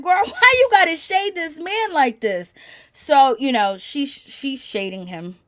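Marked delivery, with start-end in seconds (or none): 0.0-2.5 high, loud, amused protest; exasperated disbelief. Retold or imitated. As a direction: call her out, voice shooting up. Mock-challenging the wife over shade.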